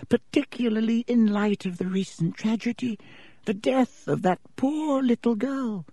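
put-on high, thin old wizard's voice, grave; a feeble headmaster explaining gently; frail old headmaster. Dippet explaining to Riddle why he must leave, high frail voice.

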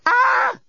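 high startled scream; sudden shriek of panic. Acted. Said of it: "said Lucy".

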